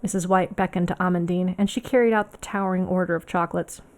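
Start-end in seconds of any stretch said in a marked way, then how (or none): none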